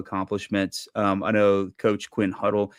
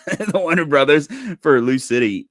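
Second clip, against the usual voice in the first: higher, louder, laughing through the words; 7 semitones higher, 6 dB louder than usual. Real, not acted. Correcting twins to Winder brothers, laughing. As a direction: laugh at your own slip.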